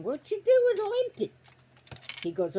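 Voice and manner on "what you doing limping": high, slow put-on voice for Papa; mock Papa teasing the dog; husband's voice. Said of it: "Papa says to him".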